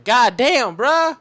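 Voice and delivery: a loud, high God damn bruh; exasperated outburst. Retold or imitated. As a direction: blurt it out, fed up. Exasperated outburst over lost Wi-Fi.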